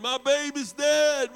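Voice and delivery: a high, loud, slow wail of grief; retold crying yell. Aunt and uncle burst into church, yelling high and loud. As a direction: cry it out like a mother whose baby just died. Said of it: "And they're yelling".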